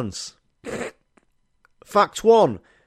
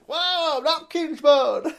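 very high protesting whoas; dragged-off customer; much slower than usual. Retold or imitated. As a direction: squeal whoa as your arms get grabbed. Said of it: "He was like".

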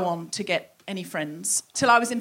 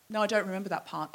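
quiet denial; 2 semitones lower, 8 dB quieter, faster than usual. Acted. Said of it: "And she said".

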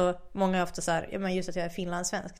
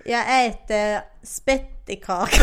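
slow, badly faked Scanian accent; skånska attempt; 7 dB louder, much slower than usual. Shown as a demonstration. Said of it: "en dålig imitation av dialekt". (långsam, överdriven låtsasskånska)

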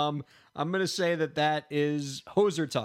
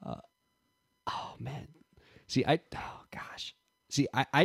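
whispering to himself; 4 dB quieter than usual. Real, not acted. Stumped contestant thinking aloud.

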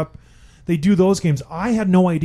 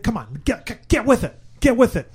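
exasperated; 6 semitones higher than usual. Real, not acted. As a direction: scold them, fed up. Scolding the NHL.

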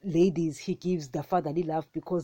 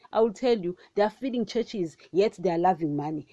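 usual speaking voice

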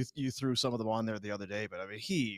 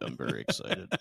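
dutiful repeat; 13 semitones lower than usual. Pretend. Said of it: "would be the correct answer".

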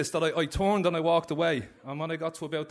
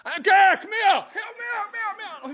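much higher, loud call across the room; 6 dB louder than usual. Retold or imitated. Inmate shouting across the visiting room to his friend. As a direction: holler to get his mate over, fading at the end.

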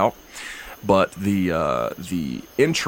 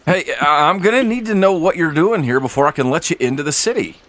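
higher, louder insistent guard; stern guard; 7 semitones higher, 5 dB louder than usual. Acted. Guard insists the party explain themselves, higher and loud. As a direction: lay down the rule at the gate.

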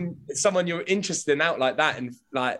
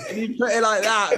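ordinary delivery

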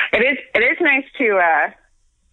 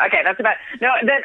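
ordinary talk, nothing marked

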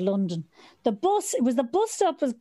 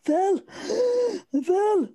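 gasping out words; 9 semitones higher than usual. Retold or imitated. Finally able to speak after being winded, breathless. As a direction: force the words out after no air.

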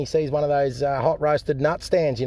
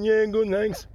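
higher cleft-palate customer voice; 6 semitones higher, slower than usual. Acted. Customer replying to the stallholder, cleft palate voice. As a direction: answer high, as the man with a cleft lip. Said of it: "This bloke got a cleft palate and a hair lip".

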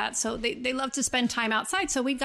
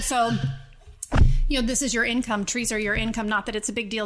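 usual speaking voice

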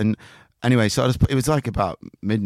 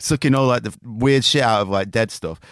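ordinary delivery

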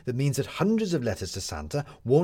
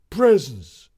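put-on loud high kid's shout; Wilson; 5 semitones higher, 10 dB louder than usual. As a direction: blurt it out like an eager child. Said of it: "said Wilson".

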